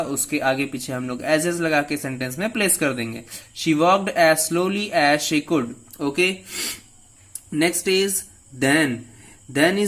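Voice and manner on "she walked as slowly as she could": slow example read. Shown as a demonstration. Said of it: "teacher reads example sentence".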